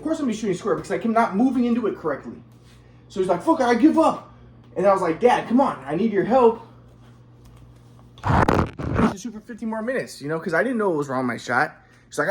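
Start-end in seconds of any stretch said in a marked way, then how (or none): 3.4-4.3 higher-pitched mock outburst; fed-up dad. Retold dad giving up again. 5.2-10.2 higher-pitched retold plea; pleading son. Retold son pleading with his dad.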